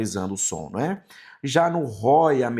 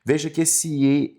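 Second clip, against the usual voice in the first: usual speaking voice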